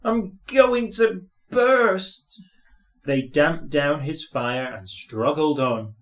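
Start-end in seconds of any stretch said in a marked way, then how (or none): 0.0-2.4 high, slow, strained wail of pain; panicked groaning. A narrator's reading. Thomas wails to his crew about bursting, slow and strained. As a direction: cry out in rising panic that you'll burst. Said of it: "groaned Thomas".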